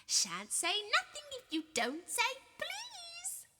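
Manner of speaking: high, slow, sing-song taunt; high mocking lilting chant; annoying sing-song. A narrator's reading. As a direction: drag it out like a nagging child. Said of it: "in his annoying sing-song voice".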